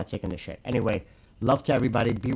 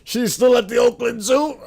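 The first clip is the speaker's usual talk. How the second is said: much higher, louder mock voice; mocking put-on voice; 12 semitones higher, 9 dB louder than usual. Streamer mocking her to chat, high put-on voice. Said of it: "Does she ever know that you're talking like this?".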